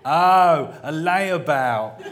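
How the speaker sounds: slow, heavy, each syllable set down deliberately; every syllable smelted. A narrator's reading. Vimes to the daughters, every syllable carefully smelted. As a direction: drag it out with icy patience. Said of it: "with every syllable carefully smelted".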